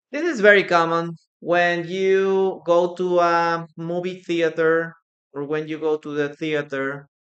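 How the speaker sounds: usual speaking voice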